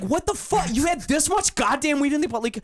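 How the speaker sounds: high-pitched, incredulous tirade; outraged rant. Retold or imitated. Confronting roommate over the huge bag of weed, outraged rant. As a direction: rant in disbelief, voice shooting way up.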